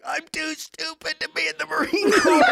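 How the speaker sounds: high-pitched mock recruit recital; hammed-up obedient high voice, near laughter; reciting recruit. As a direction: imitate a hapless private reporting to an officer. Recruit reciting the sergeant's insult back.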